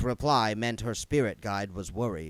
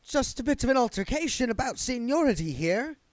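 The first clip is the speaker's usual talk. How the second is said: much higher, smug ghost voice; smug satisfaction; 14 semitones higher, 4 dB louder than usual. Acted. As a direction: savour the victory. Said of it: "his voice dripped with smug satisfaction".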